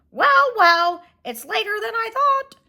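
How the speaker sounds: chirpy high blue jay voice; high bird voice. Acted. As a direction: pitch it up like a bird.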